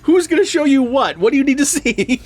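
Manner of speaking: high, incredulous string of questions; acted bafflement. Viewer questioning the man at the wall, laughingly incredulous. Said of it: "And you're like".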